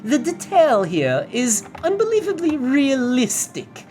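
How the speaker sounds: high, drawn-out mock upper-class accent; hammed-up first-class accent, slow and high; put-on posh period accent. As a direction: play a rich nineteen-hundreds passenger's accent. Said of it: "continue my first impressions video in this tone".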